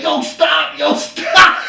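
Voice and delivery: high retold cry for it to stop; laughing, hammed-up plea; mock pleading. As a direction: imitate a man begging from the floor. Retold beaten man begging on floor.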